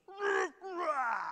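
high lion snarl; roused lion. Acted. Preacher voices the lion woken by the warrior, snarling. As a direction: growl like an irritated big cat. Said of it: "And the lion says".

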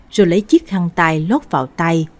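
ordinary delivery

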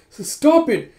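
high, loud exasperated demand; acted shouting. Girl tells the furious drunk to back off, shouting. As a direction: yell it like someone fighting off a grip.

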